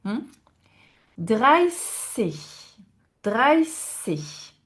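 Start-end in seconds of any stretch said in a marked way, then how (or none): 1.2-4.5 "Dreißig" with a long hissed s, no t; s, not tz. Shown as a demonstration. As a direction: stretch "Dreißig" around a plain s sound. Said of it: "on n'a pas le tz". (« Dreißig » avec un s, sans t)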